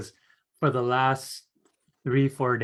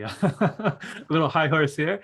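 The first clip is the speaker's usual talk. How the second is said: chuckling through the words; self-mocking giggle; laughing; 3 semitones higher, 4 dB louder than usual. Real, not acted. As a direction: laugh at yourself as you say it. Laughing at her own rant.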